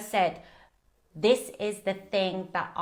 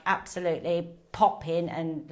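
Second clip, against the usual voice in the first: ordinary talk, nothing marked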